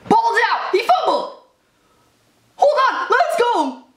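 rooting hard for the fumble; excited yelling. Real, not acted. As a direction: urge the play on, hyped. Fumble helps his fantasy team.

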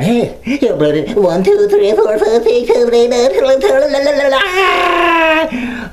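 high, hammed-up counting into a la-la chant; sing-song it, getting sillier; mock YouTube notifier. Vlogger mocking YouTube's endless upload notices, high sing-song.

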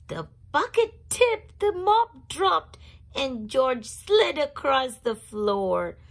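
high, loud rush of narration; dramatic narration. A narrator's reading. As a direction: build the crash up big.

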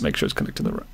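low off-mic murmur; quiet aside. Real, not acted. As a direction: mutter it off-mic.

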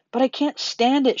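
high, loud protest; distraught shout. A narrator's reading. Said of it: "shouted Wilbur".

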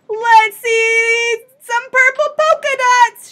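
put-on loud, high, drawn-out kid's yell; high calling-out, stretched slow. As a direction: holler it like a child in class. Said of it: "One yelled out".

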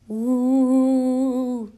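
loud, sustained "wooo"; long ghostly moan. A narrator's reading. Moan from the darkness startles the friends, loud ghostly wail. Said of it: "sounded an answer from outside".

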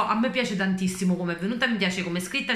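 ordinary delivery

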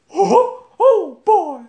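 very high, loud cartoon mouse voice; Mickey Mouse. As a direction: squeak it out like a cheerful cartoon mouse. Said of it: "the next one is Mickey Mouse".

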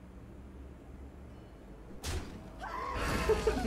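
high breathy weasel cackle; weasel laugh. Pretend. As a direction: squeak out a sneaky weasel laugh. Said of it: "You guys love my weasel impression".

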